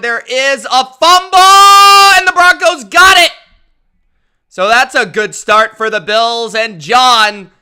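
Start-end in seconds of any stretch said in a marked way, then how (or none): 0.0-3.3 much higher, much louder play-by-play; excited fumble call. Acted. Streamer reading a viewer's super chat as a high, loud fumble call. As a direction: call the fumble like a hyped broadcaster.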